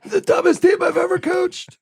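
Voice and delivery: high-pitched imitated yelling rant; coach's yell. Coach tearing into his losing team, mock yelling. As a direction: bellow like a furious movie coach. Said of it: "just yelling at them".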